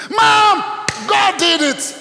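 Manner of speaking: high, loud scream of joy, the daughter retold; joyful scream. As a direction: shriek it out like she can't believe the money came. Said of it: "She just screamed".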